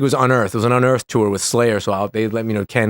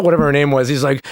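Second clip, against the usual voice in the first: normal talking voice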